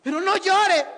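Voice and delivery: loud, high plea over wailing; exasperated consoling. Acted. Pastor to wailing sister, loud acted plea. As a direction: raise your voice to stop the crying.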